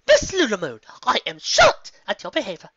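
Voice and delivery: high, loud scandalised shout; loud high appalled scolding. Acted. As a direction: shout in shocked outrage. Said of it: "Rarity shouted, appalled and just a bit angry".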